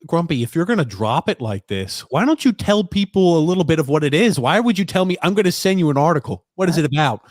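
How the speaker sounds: exasperated scolding. Real, not acted. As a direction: plead in exasperation with a co-host holding back.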